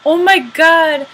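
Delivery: loud, slow "oh my god"; drawn-out excited cry; excited shout. Real, not acted. Loud exclamation.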